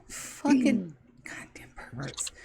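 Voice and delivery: whispered, slow curse; muttered grumble. Acted. Rower complaining about the group, muttered grumble. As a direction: grumble it slowly under your breath.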